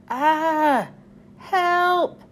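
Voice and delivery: high, frightened call for help; cry for help. Acted. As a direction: engines trapped in the shed, frightened high cry. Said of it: "called the engines".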